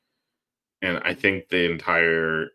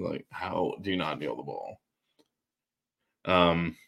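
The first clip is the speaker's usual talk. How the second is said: normal talking voice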